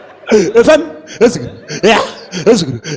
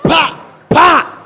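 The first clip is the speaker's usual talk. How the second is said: slap sounds; 4 semitones higher than usual. Retold or imitated.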